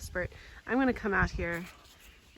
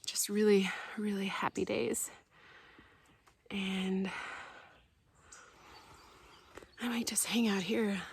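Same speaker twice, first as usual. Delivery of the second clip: whisper. Real, not acted. Fond childhood memories.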